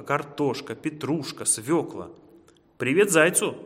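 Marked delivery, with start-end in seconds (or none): 2.8-3.6 high, loud cheery shout; called-out greeting. A narrator's reading. Mole sending greetings to the hare, shouted. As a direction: call it out as a parting hello. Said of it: "крикнул крот".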